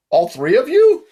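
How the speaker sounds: high, loud rising disbelief; incredulous. A narrator's reading. Guard doubts three children drowned in bathtub, incredulous. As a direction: question it in high-pitched astonishment.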